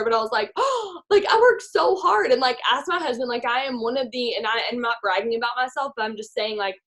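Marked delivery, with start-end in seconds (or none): 0.5-2.3 offended gasp. Retold or imitated.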